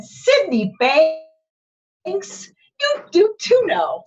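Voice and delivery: loud, high-pitched mock telling-off; exasperated wife, high and emphatic; scolding wife. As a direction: scold your husband by his full name, slowly. Voices wife scolding her husband.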